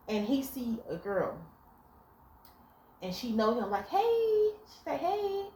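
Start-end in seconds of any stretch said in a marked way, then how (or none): 3.9-4.5 squeaky high greeting; high bright club hello; high friendly hey. Retold or imitated. 5.0-5.5 squeaky high greeting; high bright club hello; high friendly hey. Retold or imitated.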